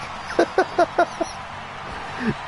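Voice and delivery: soft, high wheezing laugh; real laughter. Real, not acted. Commentator amused by a nutty match, wheezing laughter. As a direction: crack up helplessly, under the breath.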